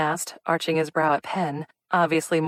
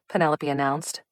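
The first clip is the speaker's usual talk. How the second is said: ordinary delivery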